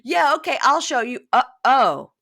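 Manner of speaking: loud, high bluster breaking off; bluster, then catch yourself in mock alarm; acted double take. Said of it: "and he goes".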